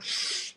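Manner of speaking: sharp whispered shush; embarrassed shush to the gym manager; hushing. Retold or imitated. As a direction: hush him with a breathy shh.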